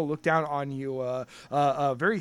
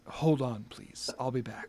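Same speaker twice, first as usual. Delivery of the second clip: quiet, lower serpent voice; low serpent waiter; 4 semitones lower, 9 dB quieter than usual. Acted. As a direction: keep it low and hushed.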